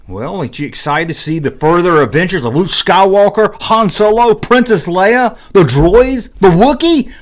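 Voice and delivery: excited fan pleading; excited kid. Retold or imitated. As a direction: rattle off the heroes like an eager kid. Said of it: "why nobody was so excited".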